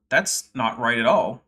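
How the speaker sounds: normal talking voice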